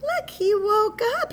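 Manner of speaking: high, loud excited squeal of words; excited, high-pitched and loud; delighted reveal. Real, not acted. Reveals the bear woke up.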